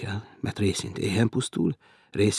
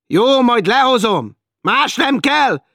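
higher, loud and slow reply; impatient shout; 11 dB louder, slower than usual. Acted. Félix answering the woman, impatient shout. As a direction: shout back to get rid of her.

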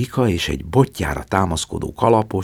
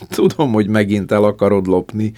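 mocking laugh-talk; 5 semitones higher, 6 dB louder than usual. A narrator's reading. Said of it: "gonyorosan nevetett".

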